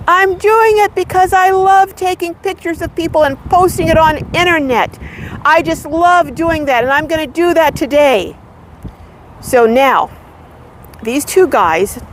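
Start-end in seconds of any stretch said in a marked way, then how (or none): 0.1-8.4 high, sustained mock calling-out; retold yell back. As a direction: call it across in a raised pitch, not truly shouting. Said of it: "And I yell back to her".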